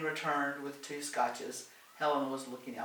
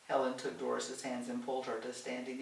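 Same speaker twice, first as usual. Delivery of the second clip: ordinary delivery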